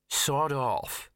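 slow, drawling, haughty cat; sniffy, very slow cat voice; snooty bored cat. Acted.

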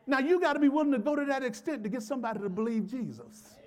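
high, insistent sermon delivery; emphatic preaching. Real, not acted. Preacher urging church to win people over, emphatic preaching. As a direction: urge the congregation on with force.

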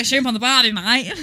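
loud mock Australian; teasing, exaggerated Australian accent. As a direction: belt out a cliché Australian accent. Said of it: "in Australian doesn't make it sound good".

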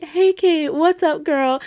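high, chirpy friendly hello; bubbly greeting. Retold or imitated. Mocking the cheery boy greeting Kate. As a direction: call out to her like a peppy friend.

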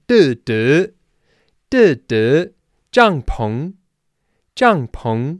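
"duh, duh, duh, duh, 帐篷" rising hard and loud; rhythmic tones sung out loud; tones hit hard. Shown as a demonstration. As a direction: punch each tone of "帐篷", high and loud. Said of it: "really hit those tones hard".

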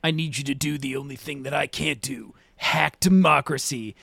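mock solemn request. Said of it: "he's like".

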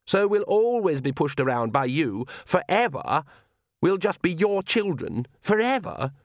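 indignant, pitch climbing to each closing word; petulant daughter. A narrator's reading. Daughter quarrels with her father.

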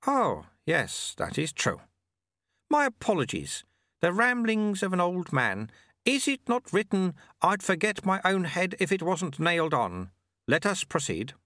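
put-on high, chirpy old man's voice; amused little old man teasing; old sweeper. A narrator's reading. Old sweeper gently mocks the shamed challenger, high and chirpy.

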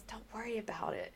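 soft whisper; whispered reassurance. Retold or imitated. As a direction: hush it like a kind secret. HR woman reassuring, hushed.

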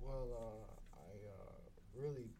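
halting, hushed and low impression; Elon Musk. Podcast guest imagined answering about Mars, halting mock impression. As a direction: haltingly fake a billionaire interviewee, softly. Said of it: "heard Elon talk like that".

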